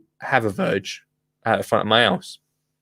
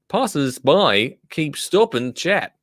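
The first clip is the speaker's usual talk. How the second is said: usual speaking voice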